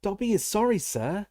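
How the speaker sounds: high anxious squeak; squeaky house elf. A narrator's reading. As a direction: squeak it out nervously. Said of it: "squeaked Dobby anxiously".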